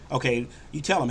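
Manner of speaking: ordinary delivery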